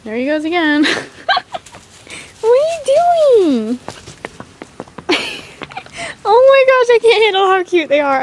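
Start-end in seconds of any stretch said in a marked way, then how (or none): 2.4-3.8 high, slow cooing. Real, not acted. Cooing at a foal. 6.2-8.2 high, bubbly gushing; gushing excitement. Real, not acted. Gushing over the foals.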